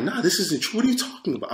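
acting out horrified refusal; horrified disbelief. Thinking back at the voice trying to convince him, horrified disbelief. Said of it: "I'm horrified".

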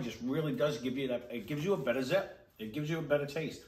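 talking between bites of pizza; speaking while chewing food; mouth partly full. Real, not acted. Seen 3: Man likely speaking holds slice at mouth, bites near end.

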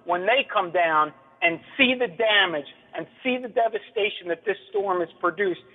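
usual speaking voice

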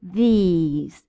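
These with a long ee, lips spread in a smile. Shown as a demonstration. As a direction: stretch the ee in These. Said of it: "Listen to the difference".

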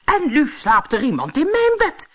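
high, loud exclamation; astonished dwarf. A narrator's reading. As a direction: announce the startling find as a little dwarf. Seventh dwarf discovers the sleeper.

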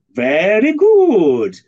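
loud, high, drawn-out Polish-accented imitation. Blind major answering how cat food tasted, imitated Polish accent. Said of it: "He said it with a Polish accent".

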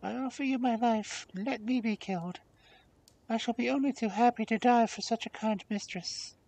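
put-on high-pitched servant girl voice; devoted servant girl.

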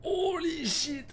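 drawn-out high startled cry; jolted by a scare, voice shooting up; panicking gamer. Real, not acted. Jumpscare in horror game.

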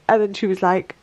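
ordinary talk

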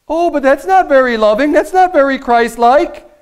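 high-pitched mock disapproval; mock offended objector. Preacher mimicking a listener offended by harsh words, mock disapproval.

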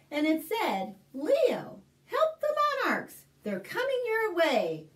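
put-on clear, lively read-aloud for little kids; story narration. Librarian reading a picture book to kids, lively storytelling.

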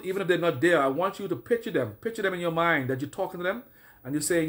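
ordinary talk, nothing marked

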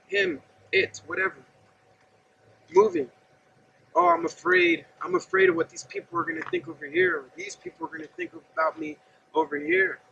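normal talking voice

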